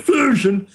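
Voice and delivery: "Fusion" exaggerated as if it were "Flusion"; mock mispronunciation. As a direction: mockingly garble "Fusion" with an added L. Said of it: "you did call it Fusion".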